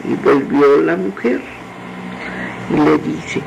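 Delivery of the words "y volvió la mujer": ordinary delivery, nothing marked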